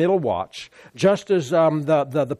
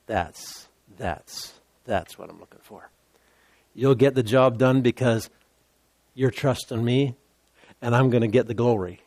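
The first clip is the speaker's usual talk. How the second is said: soft, hushed voice of God, much quieter; 8 semitones lower, 4 dB quieter than usual. Retold or imitated. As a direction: speak as God, quietly and intimately. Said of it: "And God goes".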